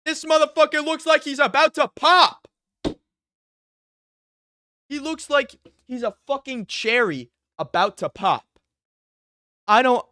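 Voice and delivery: high, loud, laughing disbelief at the screen; loud mocking outburst. Real, not acted. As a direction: shout it out, can't believe how hyped he is.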